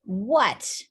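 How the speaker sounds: mildly exasperated reply; fed-up penguin, swooping through one word; annoyed. A narrator's reading. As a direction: answer with understated irritation. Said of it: "truly annoyed".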